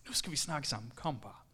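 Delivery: low sly devil voice; the devil taunting the proud one; devil's taunt. Acted. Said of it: "siger djævlen".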